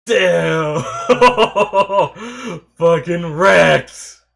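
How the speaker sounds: loud cheer broken by high laughter; exhilarated shout. Real, not acted. Viewer cheering the knockout, exhilarated shouting and laughing. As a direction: yell and laugh in real triumph.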